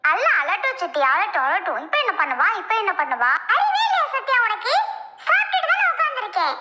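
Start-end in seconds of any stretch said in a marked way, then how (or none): 3.5-6.5 high, pitched-up scolding of the sister; high exasperated telling-off with a laugh showing. Acted. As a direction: tell her off in a raised, fed-up voice. Scolding sister for pinching baby.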